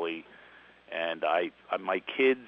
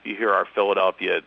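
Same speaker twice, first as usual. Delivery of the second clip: ordinary delivery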